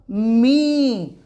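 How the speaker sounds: मी with a long drawn ī; long ī demo. Shown as a demonstration. Teacher showing students the long vowel in मी. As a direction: hold the ī in मी long. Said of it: "तो word बन जाएगा".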